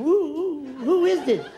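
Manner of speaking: high, loud puzzled coo; coo, then ask who it is; high surprised wife. Retold or imitated. Wife on the phone not knowing the caller, high and loud. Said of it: "And she's going".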